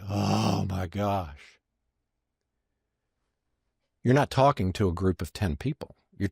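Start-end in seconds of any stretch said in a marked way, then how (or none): 0.1-1.6 long drawn-out, awed "oh"; re-enacted slow moment of revelation; dawning realization. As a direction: let it slowly sink in. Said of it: "we all got it. We're like".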